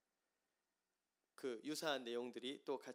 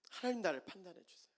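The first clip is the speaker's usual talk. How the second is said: pleading; 8 semitones higher, 5 dB louder than usual. Retold or imitated. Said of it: "간곡한 ... 울부짖으면서 도움을 요청".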